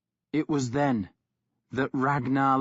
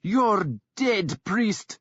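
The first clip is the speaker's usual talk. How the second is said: higher, snarling threat; snarled threat; 9 semitones higher, much slower than usual. A narrator's reading. Woman snarling a threat at the priest. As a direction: spit it at him. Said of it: "Thyra snarled at him".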